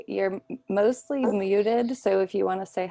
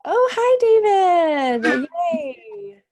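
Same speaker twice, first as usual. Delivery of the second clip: high, loud squeal of joy at a friend's arrival; delighted greeting; 12 semitones higher, 9 dB louder, much slower than usual. Real, not acted. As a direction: greet David like you're thrilled to see him.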